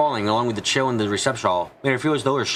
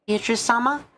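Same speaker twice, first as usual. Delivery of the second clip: higher-pitched character voice; timid plea of a character; 11 semitones higher than usual. Acted. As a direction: address her in a higher, questioning voice. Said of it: "She spoke inside her heart".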